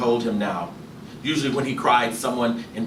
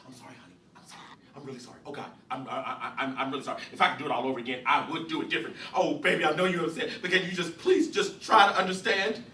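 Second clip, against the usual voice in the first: broken apology; 5 semitones higher, 4 dB quieter, faster than usual. Acted. Imogene apologizing, crushed.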